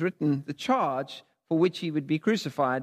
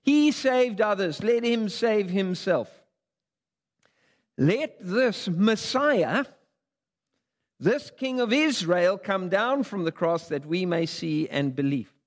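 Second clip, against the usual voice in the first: scornful mockery; 4 semitones higher, slower than usual. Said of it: "mocked him".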